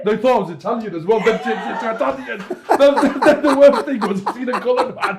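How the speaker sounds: giggling as he tells it; laughing. Real, not acted. Laughing while telling the story about his dad. Laughing retelling dad's remark.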